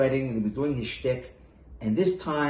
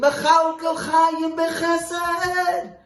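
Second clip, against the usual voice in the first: much higher, loud and very slow, an imitation cry; mimicked scream; 5 dB louder, much slower than usual. Jester imitating the Rebbe for the Rebbe himself, screaming. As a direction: give the Rebbe his own prayer-scream back. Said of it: "he jumped out of his seat and he screamed".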